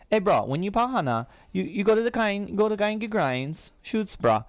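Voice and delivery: high-pitched Hawaiian pidgin; imitated Hawaiian bro voice; Hawaiian accent. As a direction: put on Hawaiian local talk, lilting high. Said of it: "the Hawaiian".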